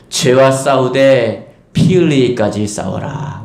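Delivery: 피 drawn out and weighted, whole line slow; slow emphatic verse. Shown as a demonstration. Preacher reciting the verse slowly to the congregation. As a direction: give each word weight. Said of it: "preacher reciting verse slowly".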